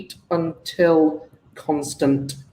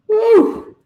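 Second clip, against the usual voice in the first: relieved whoop; 5 dB louder than usual. Real, not acted. Relieved the sum works out.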